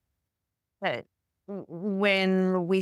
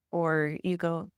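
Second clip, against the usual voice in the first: normal talking voice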